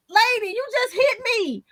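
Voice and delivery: very high-pitched mock indignation; incredulous child protest. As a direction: protest like a wronged kid, voice way up. Plays hit child protesting to parent.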